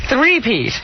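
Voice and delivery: ordinary talk, nothing marked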